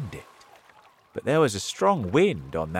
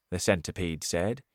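ordinary delivery, nothing marked

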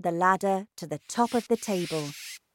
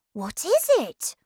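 put-on high-pitched eager child; curious boy; 11 semitones higher than usual. A narrator's reading. Boy wants grandmother's news, eager high voice.

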